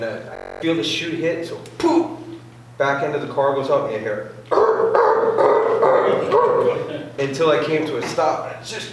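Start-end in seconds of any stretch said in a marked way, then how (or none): 1.8-2.2 high, breathy puff of a chute pop; mimicked parachute whoosh. 4.4-7.2 high, loud breathy rushing noise; long airy whoosh, pitched high; imitated whoosh. Car end lifting, imitated noise.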